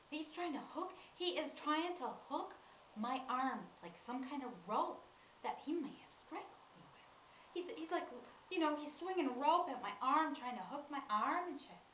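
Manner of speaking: urgent, high-pitched panic; agitated retelling. Retold or imitated. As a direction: get worked up, loud and insistent. Acts out panic at the attack.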